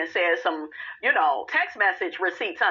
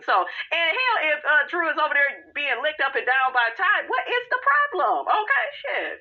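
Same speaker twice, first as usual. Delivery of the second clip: exasperated; 7 semitones higher than usual. Real, not acted. Exasperated rant about Drew.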